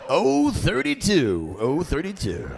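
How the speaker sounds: slow, drawn-out number calling; swooping sing-song announcer voice; bingo announcer. Retold or imitated. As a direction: call numbers across a bingo hall. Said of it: "Why do you sound like a, a bingo announcer?".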